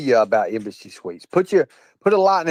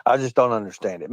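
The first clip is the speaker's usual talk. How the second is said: ordinary talk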